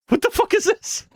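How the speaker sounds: very high squeaky disbelief; real startled outburst; shocked. Real, not acted. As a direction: react to a broken animation. Animation glitches unexpectedly.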